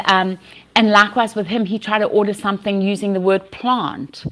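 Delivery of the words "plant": usual speaking voice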